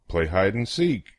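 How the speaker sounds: ordinary delivery, nothing marked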